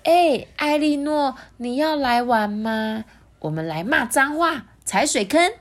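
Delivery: high slow calling out; lively friend Lulu. Acted. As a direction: call the princess to play. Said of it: "朋友露露就说".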